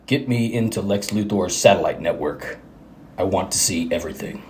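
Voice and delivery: low, soft superhero voice; hushed, very low vigilante; Batman. Acted. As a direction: drop deep and quiet, commanding. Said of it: "Kevin Conroy".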